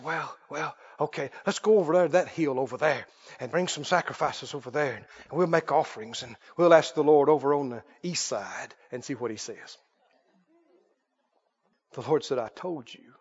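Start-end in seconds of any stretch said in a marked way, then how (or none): 0.0-9.8 low, soft, offhand suggestion; acted subdued wheedling; evasive backpedaling. Sheepish Balaam voiced, trying again. 12.4-13.1 very low and soft; acted hushed divine rebuke; quiet firm reminder. The Lord acted, repeating refusal.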